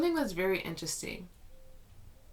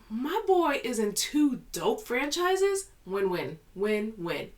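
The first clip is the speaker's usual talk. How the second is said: high, loud, slow delighted gush; retold excitement; 6 semitones higher, 5 dB louder, slower than usual. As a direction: gush like a fangirl. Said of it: "I was like".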